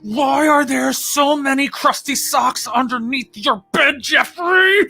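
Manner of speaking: high strained labor screams, slowed; woman giving birth. Acted. Streamer reads joke cards as a woman in labor, high straining cries. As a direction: wail each card like you're mid-contraction. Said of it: "so the accent is giving birth".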